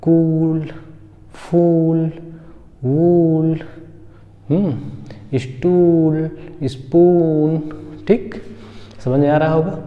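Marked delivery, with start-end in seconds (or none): 0.0-3.6 stretched "oo" in कूल, फूल, वूल; drawn-out level demo of कूल, फूल, वूल; long oo vowel. Shown as a demonstration. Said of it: "बड़ा ऊ की तरह करेंगे". 5.4-7.6 stretched "oo" in स्टूल, स्पून; drawn-out level demo of स्टूल, स्पून; long oo vowel. Shown as a demonstration. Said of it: "बड़ा ऊ की तरह करेंगे".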